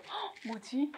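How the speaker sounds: 아주 작게 "헉, 뭐지?" 하고 당황하듯; 혼잣말로 속삭이듯 놀라서; 당황한 속말. Pretend. 학생이 문제 보고 당황함. (문제를 보고 당황한 학생 흉내, 아주 작은 속말)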